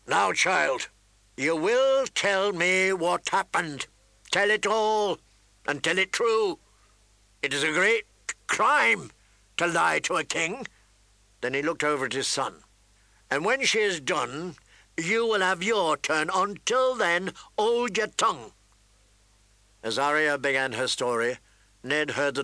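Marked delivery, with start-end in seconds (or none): 0.1-10.7 raised, forceful, commanding voice; loud, weighty royal command; stern king. A narrator's reading. Irritated king commands Arya to testify. 13.3-18.5 higher, forceful order; emphatic royal rebuke; stern king. A narrator's reading. King orders his son to wait.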